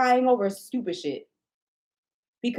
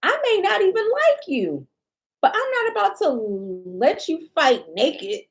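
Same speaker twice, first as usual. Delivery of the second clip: indignant squeal; 7 semitones higher than usual. Real, not acted. Voice jumps high in outrage.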